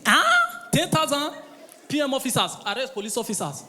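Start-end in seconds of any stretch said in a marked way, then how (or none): 0.0-1.3 high loud shocked cry at the sum; hammed-up shock at the offer; outraged disbelief. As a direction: scoff at the tiny bribe.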